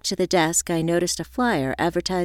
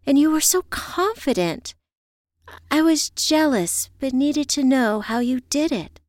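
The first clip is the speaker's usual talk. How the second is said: higher-pitched, earnest friend confiding; unhappy friend; 7 semitones higher than usual. Acted. Friend confiding in Evie how unhappy she was, earnest. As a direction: pour out admiration and regret, voice lifted. Voiced character on a phone call.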